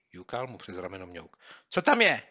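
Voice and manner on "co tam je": much higher and louder, impatient; impatient urging. Acted. Boy pestering his friend to read, impatient. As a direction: hurry him along.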